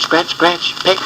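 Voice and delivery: usual speaking voice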